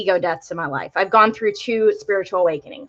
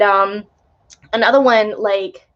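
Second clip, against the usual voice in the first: ordinary talk, nothing marked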